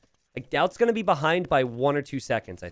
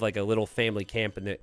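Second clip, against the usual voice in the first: normal talking voice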